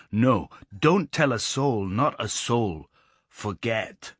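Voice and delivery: slow, deliberate warning; serious, emphatic. A narrator's reading. Lad swearing the climbers to secrecy, slow and emphatic. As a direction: press each word slowly, don't tell a soul. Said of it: "He grew very serious and emphatic".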